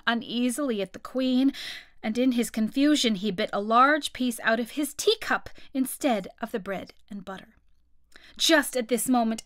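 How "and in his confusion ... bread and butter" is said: ordinary delivery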